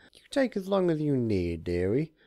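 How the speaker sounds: hammed-up high cheer; cheerful Discord. A narrator's reading. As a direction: shoo her off brightly.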